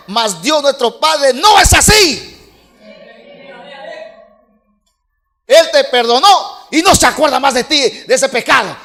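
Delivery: a preacher building to a passionate shout about God's forgiveness; fiery preaching. Acted. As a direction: thunder it at the congregation. Preacher rises to full force.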